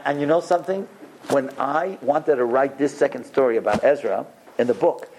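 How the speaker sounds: normal talking voice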